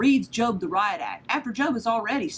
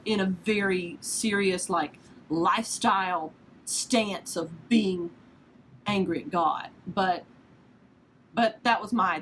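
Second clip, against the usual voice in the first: ordinary talk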